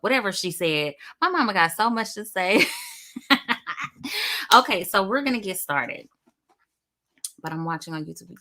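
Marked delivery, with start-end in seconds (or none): none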